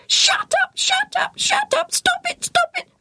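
very high repeated squawks; screeching parrot. A narrator's reading. Parrot perched on the boy's head, screeching. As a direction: screech like a bird stuck on a phrase. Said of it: "he screeched".

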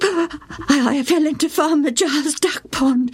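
put-on high, faltering boy's voice; sheepish boy. A narrator's reading. Joe confessing to his mother, high and hesitant. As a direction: own up to mum haltingly.

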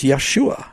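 Yeshua said the Hebrew way, "yeh-SHOO-ah"; Hebrew pronunciation. Shown as a demonstration. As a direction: say Yeshua with the stress on SHU. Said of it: "In, uh, Hebrew".

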